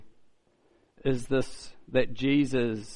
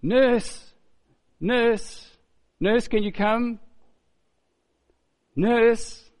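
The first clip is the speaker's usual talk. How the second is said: high-pitched imitation of an old lady; plaintive mock calling-out; 8 semitones higher, 3 dB louder, much slower than usual. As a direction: call for the nurse from half-sleep, high and needy. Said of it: "somewhere in her slumber, she starts to say".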